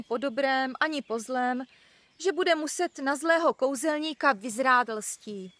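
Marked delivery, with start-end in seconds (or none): none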